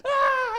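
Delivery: put-on high screaming yelp of a scared kid; sudden panicked scream; terrified yelp. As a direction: shriek like you're about to get beaten up.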